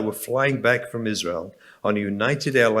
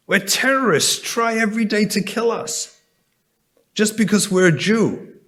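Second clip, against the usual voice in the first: impassioned preaching; 7 semitones higher than usual. Real, not acted. Impassioned sermon about persecution.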